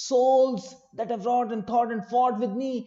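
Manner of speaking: slow recital with a held pause after "souls"; captain rallying his sailors, pause after "souls". Shown as a demonstration. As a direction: stretch "souls", pause, then roll on slowly. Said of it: "we feel like pausing after soul".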